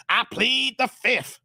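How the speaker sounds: slow, spaced-out catchphrase; sketch catchphrase. Retold or imitated. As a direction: stretch each word for comic effect. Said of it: "then it's like".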